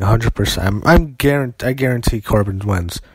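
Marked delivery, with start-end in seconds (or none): none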